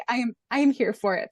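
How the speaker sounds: ordinary delivery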